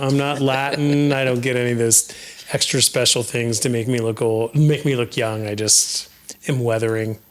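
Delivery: normal talking voice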